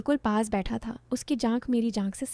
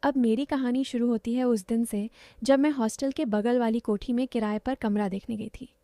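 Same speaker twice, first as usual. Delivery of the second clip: usual speaking voice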